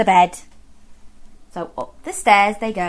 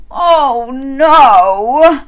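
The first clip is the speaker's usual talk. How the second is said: higher, louder exasperated bear voice; fed-up bear; 4 semitones higher, 7 dB louder than usual. Acted. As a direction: groan it like a sleepless bear. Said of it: "said Mister Bear".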